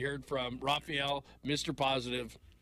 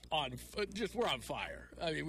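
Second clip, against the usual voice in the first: usual speaking voice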